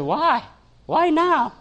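very high, squeaky-pitched questioning; hammed-up startled question, pitched high; mock alarm. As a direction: leap right up in comic disbelief. Mock alarm at her request, retold.

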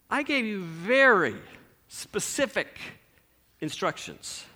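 slow, deliberate, fading rebuke; father dropping his voice to rebuke the son; stern father. Acted. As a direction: spell out each word like a disappointed dad.

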